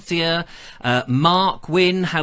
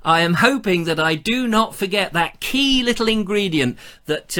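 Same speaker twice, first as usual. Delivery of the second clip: usual speaking voice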